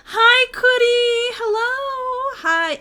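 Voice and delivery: high, slow, loud sing-song hello; excited greeting. Real, not acted. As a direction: greet a friend with delight.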